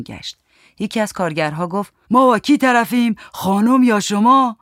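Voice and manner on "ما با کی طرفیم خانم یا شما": higher, louder demand; demanding workman. A narrator's reading. Workman asking who he deals with, demanding. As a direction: press for an answer in a raised voice.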